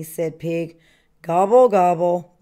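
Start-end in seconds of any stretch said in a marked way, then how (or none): 1.2-2.3 louder, grumpy gobbling; grumbled gobble. A narrator's reading. Turkey reacts to Pig's criticism, gobbling in a loud grumble. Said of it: "grumbled Turkey".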